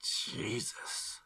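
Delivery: whispered in shock; murmur. Acted. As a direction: breathe it out, stunned. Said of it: "Robbie murmurs".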